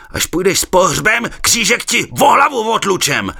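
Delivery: loud high threatening yell; commander rasping threat. A narrator's reading. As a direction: threaten him hoarsely at full voice. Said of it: "chraptěl velitel".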